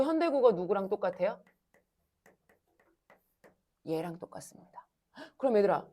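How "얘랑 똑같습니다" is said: whispered, no voice. Real, not acted. Teacher whispering the answer to the class.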